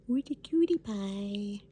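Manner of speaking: ordinary talk, nothing marked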